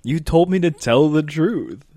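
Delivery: loud, high, drawn-out objection; slow, hammered-out self-defence; mock indignant protest. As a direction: protest like a wronged kid. Retold protest continues.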